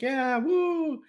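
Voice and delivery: high excited whoop; crowd cheer. Retold or imitated.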